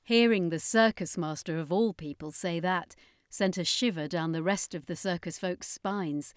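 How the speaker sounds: usual speaking voice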